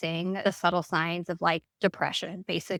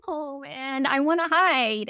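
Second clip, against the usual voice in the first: pitched-up sulky inner voice; fed-up inner voice; 9 semitones higher than usual. Retold or imitated. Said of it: "and I'm like".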